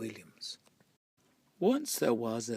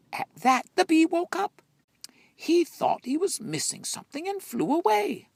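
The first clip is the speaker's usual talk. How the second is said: excited; 8 dB louder than usual. A narrator's reading. Bee wakes, excitement.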